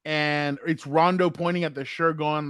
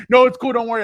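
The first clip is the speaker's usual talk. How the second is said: higher, louder nervous brush-off; mock squirming; 7 semitones higher, 7 dB louder than usual. Teasing a friend who dodged meeting the family, mock squirming. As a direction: imitate someone squirming out of an invitation. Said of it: "Gilbert was all squirming".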